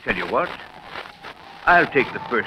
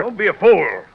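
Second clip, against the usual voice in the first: sharp rebuke; 9 semitones higher, 5 dB louder than usual. Acted. Father refuses water.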